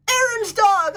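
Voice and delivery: light up at the dog's name; excited exclamation. Real, not acted. Finding a viewer's dog.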